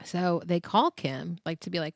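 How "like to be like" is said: ordinary talk, nothing marked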